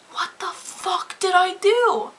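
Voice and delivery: high, slow, incredulous yell; outraged comeback. Retold or imitated. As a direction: demand an answer, furious and disbelieving. Arguing back at the driver.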